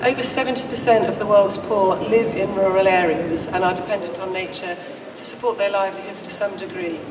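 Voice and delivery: ordinary talk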